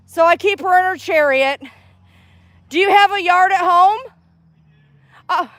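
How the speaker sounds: high, bright chatting voice to a stranger; friendly stranger voice. Real, not acted.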